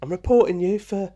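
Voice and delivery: high-pitched character impression; Penny Carter. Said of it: "That was a really good impression".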